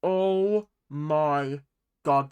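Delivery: normal talking voice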